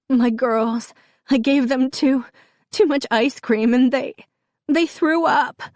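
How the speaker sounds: high, broken, halting confession; sobbing. A narrator's reading. Mother confesses to Gus about the ice cream, sobbing. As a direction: choke it out in rising sobs. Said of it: "My sobbing intensifies".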